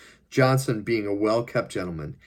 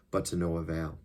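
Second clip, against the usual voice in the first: ordinary delivery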